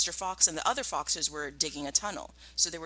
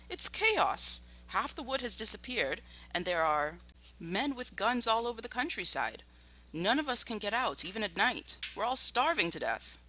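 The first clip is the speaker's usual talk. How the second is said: high and loud, agitated rush of news; excitedly; 6 semitones higher, 5 dB louder than usual. Acted. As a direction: pour out the bad news in alarm. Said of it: "Badger said excitedly".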